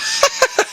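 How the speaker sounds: short, high breathless giggle; helpless laughter. Real, not acted. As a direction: crack up while trying to hold on. Breaks up at the song.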